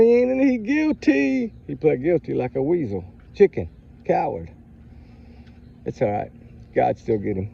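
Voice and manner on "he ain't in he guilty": mock quote. Mocking a defendant's plea.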